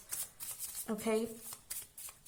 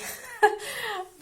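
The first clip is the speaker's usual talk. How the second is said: a high, teary laugh through near-crying; a real, choked little laugh that breaks toward tears; tearful laugh; 11 semitones higher than usual. Real, not acted. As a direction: laugh it off while about to cry. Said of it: "trying my best not to cry".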